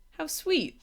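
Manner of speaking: high, cooing cry of delight. A narrator's reading. Snork Maiden admiring the dragon, delighted cry. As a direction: squeal over something cute. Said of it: "the Snork Maiden cried".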